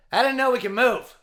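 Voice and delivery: loud, punchy jab; mock quip. Garry Shandling teasing Robert Klein, loud punchline. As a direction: land the quip loudly.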